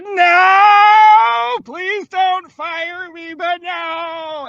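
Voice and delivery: long high wailing "no"; hammed-up panic. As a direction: mock-desperate high plea. Retold horrified refusal to bosses.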